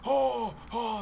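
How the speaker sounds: high "oh, oh" of discovery; excited realization. Real, not acted. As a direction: exclaim high as it finally works. Excited that the stack works.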